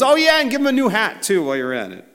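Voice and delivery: high, loud, quick burst of eagerness; excited. Acted. Preacher playing Zechariah piping in on the vision, excited. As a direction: pipe in, thrilled and rushing. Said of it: "He's so excited".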